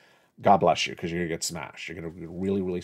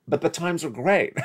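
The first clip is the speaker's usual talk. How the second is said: sarcastic; 10 semitones higher than usual. Acted. Mocking the fake doom videos.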